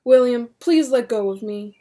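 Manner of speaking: plain reading voice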